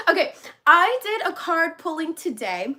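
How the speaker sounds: usual speaking voice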